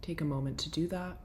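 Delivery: soft, settling guide voice; guided breathwork. Acted. Settling listeners for breathwork, in a soft guiding voice. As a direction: ease the listeners gently into the session.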